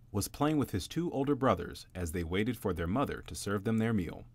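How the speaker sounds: ordinary delivery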